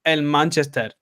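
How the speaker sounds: English city name in a Spanish accent. Shown as a demonstration. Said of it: "in, in like a Spanish".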